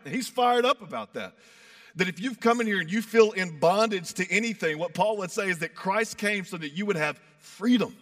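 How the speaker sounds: usual speaking voice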